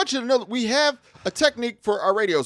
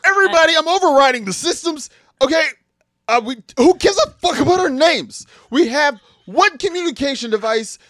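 loud, raised-pitch takeover of the channel; loud override announcement; 4 semitones higher, 6 dB louder than usual. Acted. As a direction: cut over everyone, fed up and loud. Seizes radio to stop arguing.

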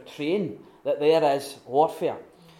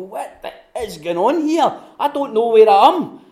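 higher, loud and lost; confused outcry; 6 semitones higher, 11 dB louder than usual. Retold or imitated. Weary believer lost in the fog, loud and bewildered. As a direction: cry out like someone who can't find their way.

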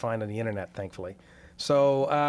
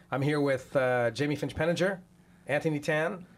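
ordinary talk, nothing marked

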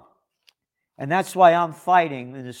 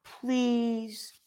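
mock pleading; 6 semitones higher, 6 dB quieter than usual. Mocking the meek pleading citizen.

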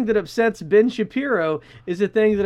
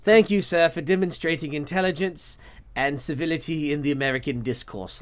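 put-on softer, lower, dry British accent; British and sarcastic; 4 semitones lower, 3 dB quieter than usual. British interviewer thanking his angry guest, sarcastic British accent. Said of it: "super British and sarcastic".

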